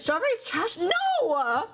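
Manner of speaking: high, incredulous protest; can't believe what chat just said; shocked high-pitched no; indignant disbelief. Real, not acted. Chat calls strawberry trash.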